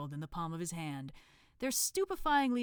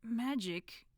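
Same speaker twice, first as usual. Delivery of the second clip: nervous, trailing off; 4 semitones higher, 3 dB louder than usual. Acted. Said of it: "his voice trailed off".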